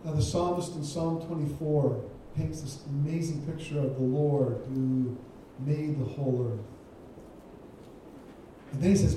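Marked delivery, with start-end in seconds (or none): none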